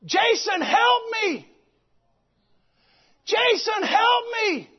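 high, loud pleading shout; retold dream cry, shouted high and loud; desperate cry for help. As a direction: call out for rescue from the dark. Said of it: "She started crying out".

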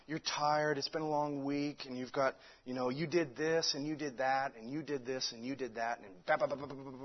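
low, hushed voice listing accusations; imitated devil's whispering. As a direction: murmur discouragement into someone's ear, trailing into babble. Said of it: "whispering in your ear authoritatively".

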